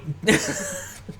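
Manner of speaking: high burst of laughter; real laugh breaking the bit. Real, not acted. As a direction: crack up mid-impression.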